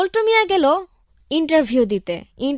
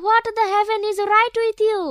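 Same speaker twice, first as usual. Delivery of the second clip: mocking high reply; 8 semitones higher, much slower than usual.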